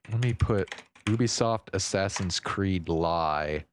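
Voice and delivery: normal talking voice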